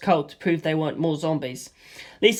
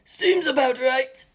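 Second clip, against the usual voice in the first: higher, slow, dry amused remark; Stephen, amused; 7 semitones higher, slower than usual. A narrator's reading. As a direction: chuckle it out.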